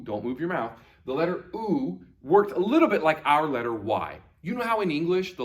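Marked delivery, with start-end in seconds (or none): none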